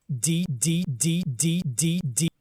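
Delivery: loud repeated D stutter; stutter drop. Shown as a demonstration. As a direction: stutter the letter D. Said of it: "This is how I would do a stutter".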